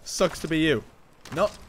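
ordinary talk

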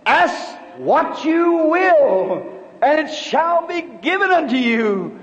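a slow, solemn voice speaking down from above. Retold or imitated. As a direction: let each word fall slowly like a command from heaven. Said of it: "I heard it again".

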